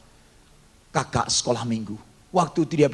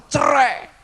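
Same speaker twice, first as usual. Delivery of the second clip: father's shout; 11 semitones higher, 6 dB louder than usual. Retold or imitated. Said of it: "papa saya berkata".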